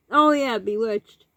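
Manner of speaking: normal talking voice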